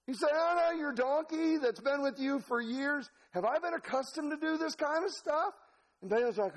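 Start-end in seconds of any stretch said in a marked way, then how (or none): 0.3-5.5 high-pitched comic animal voice; pleading donkey. Acted. Donkey reasons with longtime master, high pleading voice. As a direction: protest as the wronged donkey.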